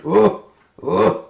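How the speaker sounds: short loud grunted growls; bear growl. A narrator's reading. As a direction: growl like an old bear, twice. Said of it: "It just growls and goes".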